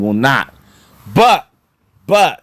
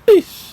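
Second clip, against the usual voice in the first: a short, very high "eesh" of sticker shock; wincing eesh; 4 dB louder than usual. Real, not acted. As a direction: wince out a quick high "eesh". Reacting to courtside price.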